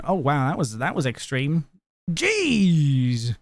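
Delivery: ordinary talk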